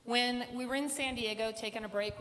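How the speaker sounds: normal talking voice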